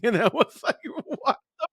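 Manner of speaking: real laughter breaking through the words; laughing. Real, not acted. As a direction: laugh through the line in disbelief. Laughing while retelling shocked reply.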